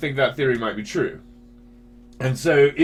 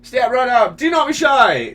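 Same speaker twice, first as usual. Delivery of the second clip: very high carnival barker; a British crowd announcer, pitched way up; high-voiced British dude; 12 semitones higher, 8 dB louder than usual. Shown as a demonstration. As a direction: call out like a showman way up high. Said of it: "Things like that".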